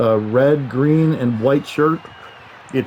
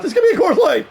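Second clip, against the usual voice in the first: high, loud scared squeak; mock frightened; 5 dB louder than usual. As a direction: squeal it like a cowering customer. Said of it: "I was so afraid of him".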